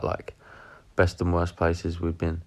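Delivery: ordinary talk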